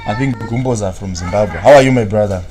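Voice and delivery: ordinary delivery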